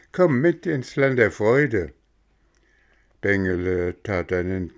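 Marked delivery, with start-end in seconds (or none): 0.1-1.9 higher, louder sweet coaxing; coaxing coachman. Acted. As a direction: cheer the sad boy up to lure him. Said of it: "sprach da das dicke Männchen".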